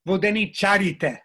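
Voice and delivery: Vodeničarite, stress moved onto ČA; loud, demonstrative vodeniČArite; antepenultimate stress. Shown as a demonstration. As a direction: punch the ČA in Vodeničarite, loudly. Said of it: "That's how people talk".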